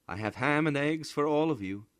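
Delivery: lightly voiced mother. A narrator's reading. Mother at the kitchen door, lightly voiced. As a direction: call the kids in for breakfast.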